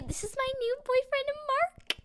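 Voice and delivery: very high excited announcer voice. Acted. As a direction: gush it out high to the fans. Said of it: "she said".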